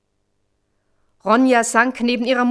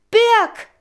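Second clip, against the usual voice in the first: high, loud cry of distress; desperate shout; 11 semitones higher, 5 dB louder than usual. A narrator's reading. Ronja searches for Birk in the water, crying out desperately. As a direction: call out for someone lost. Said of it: "schrie sie in ihrer Not".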